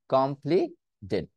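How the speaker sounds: কমপ্লিটেড slowly with a d; slow flapped t. Shown as a demonstration. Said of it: "ডিটাকে আবার নিয়ে আসতে পারতেছি".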